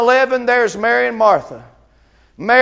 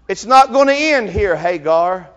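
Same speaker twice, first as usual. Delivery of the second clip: louder, building preacher's cadence; impassioned sermon build; fervent preaching; 2 semitones higher, 5 dB louder than usual. Acted. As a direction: press each "I know" harder. Preaches to Hagar and congregation.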